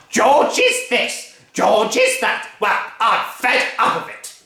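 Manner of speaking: bully the whole family; furious Grandma. Acted. Grandma demanding her breakfast, loud bullying bellow. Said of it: "shouted Grandma".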